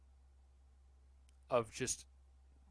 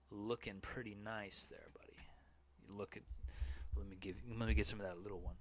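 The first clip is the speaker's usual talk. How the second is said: creepy; 4 semitones lower, 5 dB quieter, faster than usual. Acted. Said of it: "getting creepy".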